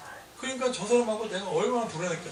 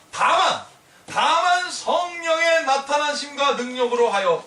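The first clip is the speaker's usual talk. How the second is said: louder, slower emphatic preaching; 4 semitones higher, 5 dB louder, slower than usual. Acted. Preacher proclaiming scripture to the congregation, forceful. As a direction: drive the verse home.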